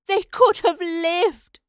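slow, high, loud anguished cry; weeping. Acted. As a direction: grieve over lost girls. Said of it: "the professor wept".

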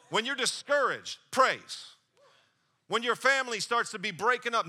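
full-force sermon delivery; preaching. Acted. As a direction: drive it home to the congregation.

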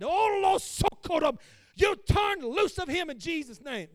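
loud, high shouting prayer; hammed-up mama shouting a prayer; fervent mama prayer. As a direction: wail it out like mama praying in her closet. Mother praying in closet.

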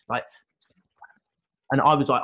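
usual speaking voice

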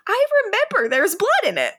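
high, squealing amusement; laughing. Real, not acted. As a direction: say it through laughter, pitch way up. Still cracking up over red champagne.

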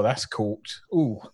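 ordinary talk, nothing marked

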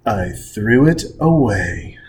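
slow, low, flat confession; deadpan low mutter. Retold or imitated. Steve Martin reenacted telling the clerk, deadpan. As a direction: drop it quietly, no apology.

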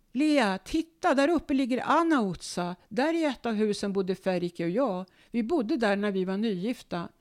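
mother pointing up the hill, thrilled; excited old mother. Retold or imitated. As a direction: call out like a girl who spots home.

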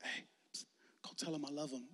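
soft secret whisper; psst, lean in and whisper it; God whispering the nudge. Retold or imitated.

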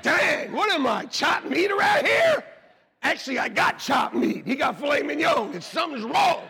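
high, loud mock indignation; hammed-up complaint; mock outrage. Son shouting to his mom about his chopped meat, in mock outrage.

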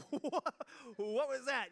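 startled laughing what; laughing surprise. Retold or imitated. Student startled by the president, laughing surprise. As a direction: laugh out a stunned question. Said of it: "I'd be like".

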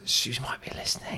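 whispered, secretive; hushed aside. Acted. Husband worried his wife might be listening, whispering. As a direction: drop into a furtive whisper.

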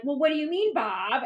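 mock a condescending trainer putting Bob down; snotty voice. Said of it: "your really snotty voice".

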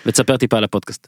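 ordinary delivery

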